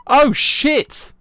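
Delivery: high, loud yelp of fright; real startled shout. Real, not acted. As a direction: jump out of the chair yelling. Jump scare in the game.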